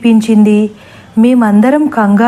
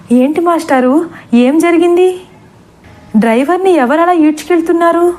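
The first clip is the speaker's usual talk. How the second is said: higher, panicky questions; frightened; 7 semitones higher than usual. Acted. Sarala madam asks the master what happened, frightened. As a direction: ask in fear, voice pitched up. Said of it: "Sarala madam భయపడుతూ".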